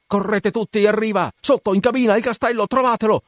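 high-pitched, frantic search command; urgent cries, pitched right up; frantic orders. Acted. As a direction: send the crew running.

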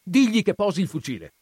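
loud, high, rushed cry; shouted order. A narrator's reading. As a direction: shout it urgently, pitched well up. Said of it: "gridò".

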